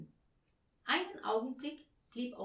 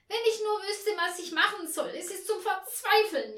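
high, loud despairing lament; shrill wailing; 12 semitones higher, 10 dB louder than usual. A narrator's reading. Woman lamenting through the walls of a house, shrill wail. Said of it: "jammerte die etwas sehr schrille Stimme".

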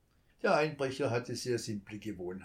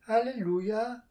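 put-on high, louder puzzled child's echo; wondering child; 10 semitones higher, 7 dB louder than usual. A narrator's reading. Child repeats the word, wondering. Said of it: "wunderte sich das Kind".

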